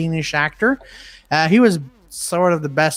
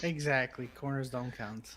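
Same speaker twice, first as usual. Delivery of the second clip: low, much softer voice; 5 semitones lower, 17 dB quieter than usual. Real, not acted. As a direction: drop the voice down low and quiet. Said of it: "[name]'s voice has gotten very low".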